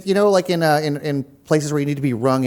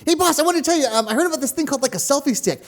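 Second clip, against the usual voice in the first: high-pitched eager lackey; chirpy overcaffeinated intern voice; high eager assistant; 9 semitones higher, faster than usual. Acted. Playing the cameraman Gregory.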